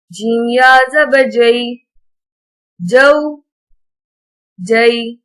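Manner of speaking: gentle, even Qaida recital, no stretching or jerking; patient teacher's drill reading. Acted.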